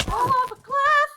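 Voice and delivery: high, very loud drawn-out yell; sudden shriek breaking out mid-sentence; alarmed yell. Real, not acted. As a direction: yell as you tumble off a cliff. Falls off cliff while riding.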